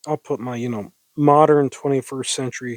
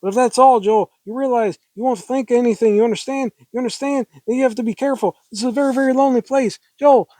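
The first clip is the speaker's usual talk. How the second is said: high, rushed, pleading heroine; hysterical woman; 8 semitones higher, faster than usual. Acted. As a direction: beg him to understand, frantic and pitched up. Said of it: "I like being kinda hysterical".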